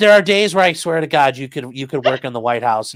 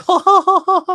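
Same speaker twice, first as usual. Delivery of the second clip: high, loud mock laugh; imitated laughter; 4 dB louder than usual. Man imitating his partner laughing at his panic, mock laugh. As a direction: mimic her laughing at you. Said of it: "she keeps laughing".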